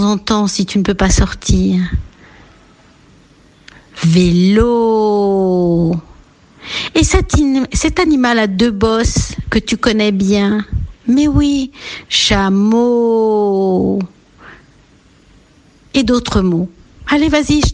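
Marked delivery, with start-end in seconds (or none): 4.0-5.9 Vélo with a long held final O; final O stretched. Shown as a demonstration. Teacher gives a rhyme for O, Vélo ending long. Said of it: "cherche des mots où on entend O". 12.1-14.0 chameau with a long held final O; final O stretched. Shown as a demonstration. Teacher gives a rhyme for O, chameau ending long. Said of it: "cherche des mots où on entend O".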